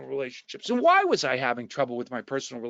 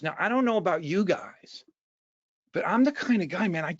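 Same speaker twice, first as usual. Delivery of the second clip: ordinary talk, nothing marked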